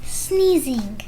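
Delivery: lively picture-book read-aloud with character voices; reading to children. Acted. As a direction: read it like story time for little kids.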